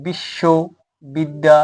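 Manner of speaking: বিশ্ববিদ্যালয় slowly split into chunks, a short pause after each; syllable-by-syllable demonstration. Shown as a demonstration. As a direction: break বিশ্ববিদ্যালয় into বিশ্ব, বিদ্যা, লয়. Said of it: "আমরা যদি এভাবে বলি যে". (বিশ্ববিদ্যালয় ভেঙে ভেঙে, ধীরে উচ্চারণ)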